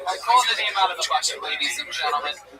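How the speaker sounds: game-show host calling the next contestant; mock host announcing a guest; announcer intro. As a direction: hype up the name like a host.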